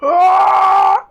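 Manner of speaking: high, loud pained scream; loud high-pitched cry of agony; yell of pain. Acted. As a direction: scream out as a blast hits you. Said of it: "him yell in pain".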